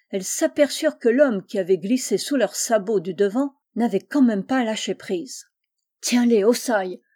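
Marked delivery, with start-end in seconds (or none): 6.0-7.0 louder, firm call for help; urgent cry. A narrator's reading. Injured man calls to his companion to hold the horses, urgent cry. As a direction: call out the order sharply. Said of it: "s'écria-t-il".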